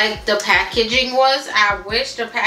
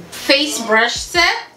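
ordinary talk, nothing marked

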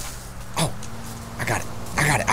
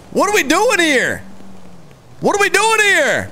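exasperated; 9 semitones higher than usual. Real, not acted. Exasperated at slow backup.

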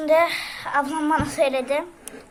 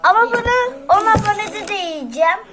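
raised, energetic promise; loud excited vow; 6 semitones higher, 7 dB louder than usual. Real, not acted. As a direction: call it out loudly. Vowing to eat it again.

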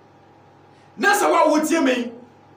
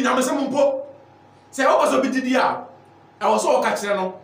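ordinary talk, nothing marked

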